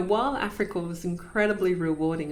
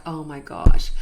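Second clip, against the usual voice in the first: shocked exclamation; 3 semitones lower, 12 dB louder than usual. Retold or imitated. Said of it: "And I was just like".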